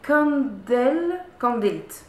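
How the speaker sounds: slow, high, careful English accent on "candelles, candélites"; attempted English accent. Real, not acted. Vlogger apologising for her English reads a candle brand, attempted English accent. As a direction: stretch out "candelles, candélites" loudly in shaky-French English accent. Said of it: "excusez d'avance mon accent anglais".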